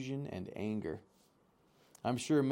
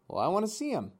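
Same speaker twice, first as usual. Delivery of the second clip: put-on eager young gosling; 10 semitones higher, 4 dB louder, much faster than usual. A narrator's reading. Gosling demands to see.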